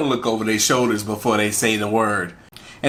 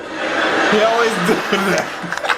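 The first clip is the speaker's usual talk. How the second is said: laughing; 8 semitones higher, much slower than usual. Real, not acted. Laughing at the clip.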